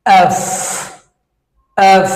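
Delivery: এফ ending in a long airy f; breath released. Shown as a demonstration. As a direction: let the breath flow out on the f of এফ. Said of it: "breath will come out".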